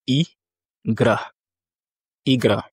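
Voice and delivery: I, gra split into two syllables, then Igra. Shown as a demonstration. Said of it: "deux syllabes".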